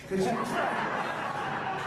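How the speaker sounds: high breathy laugh through the words; giggling admission. Real, not acted. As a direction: laugh it out, self-mocking. Laughs at his own jerkiness.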